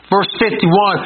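ordinary talk, nothing marked

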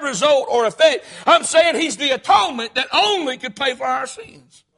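loud, rising preacher's cry; preaching at full force. Acted. Pastor to the congregation, preaching loud. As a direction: drive it home like a revival preacher.

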